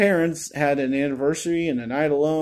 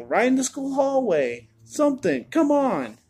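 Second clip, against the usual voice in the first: pitched way up, pleading with the movie; rising exasperation, much higher; exasperated; 9 semitones higher than usual. Real, not acted. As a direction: come on, you blew it. Exasperated rant about the movie.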